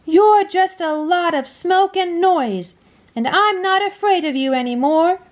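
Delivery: put-on boy defying a rhino; brave cry. A narrator's reading. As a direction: stand up to the beast.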